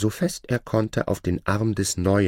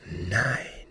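astonished whisper; 6 dB quieter than usual. Acted. Scholar stunned by shadow's wealth.

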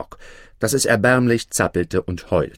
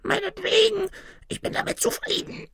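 very high, squeaky whine, falling softer; whimpering tiny man; 5 dB quieter than usual. A narrator's reading. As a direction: whimper it as a little gnome. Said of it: "winselte das winzige". (winselndes Männlein mit hoher Piepsstimme)

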